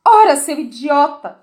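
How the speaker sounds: loud, slow angry insult; cursing queen. A narrator's reading. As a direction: spit the insult out, furious and slow. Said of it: "xingou a rainha". (xingamento furioso da rainha, alto e lento)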